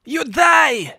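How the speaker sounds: loud gleeful exclamation. Real, not acted. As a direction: shout it with triumph. Mocking a greedy player's death.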